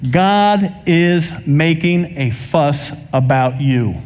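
very slow, deliberate preaching line; measured proclamation, dropping on "you"; slow emphasis. Real, not acted. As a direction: stretch out each word to drive it home. Preacher drives home key line.

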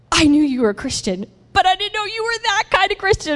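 gushing, climbing to a high squeal; imitating excitement. Deli worker discovering her kind of faith, high excited imitation. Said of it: "So she was so excited".

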